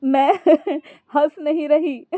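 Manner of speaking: helpless cackling, words high; laughing. A narrator's reading. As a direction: struggle to explain through laughter. Wife explains while unable to stop laughing. (हंसी से बेहाल)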